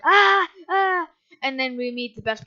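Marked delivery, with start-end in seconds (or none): 0.0-1.1 mock screams of a fleeing crowd, pitched up and loud; higher, louder imitated screams; panicked screams. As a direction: yelp it like the bar crowd fleeing sharks. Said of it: "and then everyone's like".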